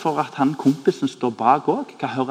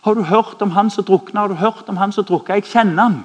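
upset woman; 6 semitones higher, 4 dB louder than usual. Retold or imitated. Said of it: "hun var helt fra seg".

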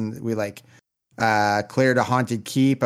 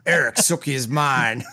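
hammed-up Southern TV impression; Southern accent. Co-host quoting True Blood for laughs, Southern accent. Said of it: "get, like, Southern accent at all".

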